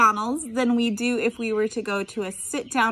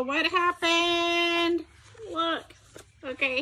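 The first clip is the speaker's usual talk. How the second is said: usual speaking voice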